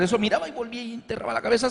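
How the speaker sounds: usual speaking voice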